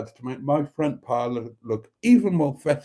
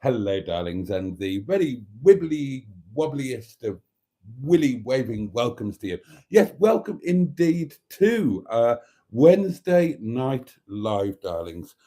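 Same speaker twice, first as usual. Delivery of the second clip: campy drag queen. Acted. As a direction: gush over the audience theatrically. Camp show host greeting.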